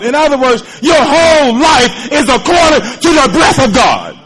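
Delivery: loud, rising sermon cadence; full-force preaching. Acted. Preacher exhorting the congregation at full force. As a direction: drive it home to the church.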